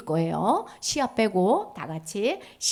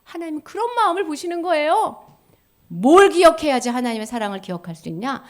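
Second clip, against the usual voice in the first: ordinary delivery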